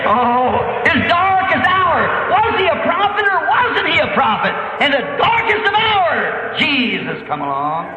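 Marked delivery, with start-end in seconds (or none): 0.0-6.6 high, drawn-out pulpit shout; ringing revival preacher's cry; impassioned preaching. Real, not acted. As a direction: proclaim it fervently to the congregation. Preaches at full pitch.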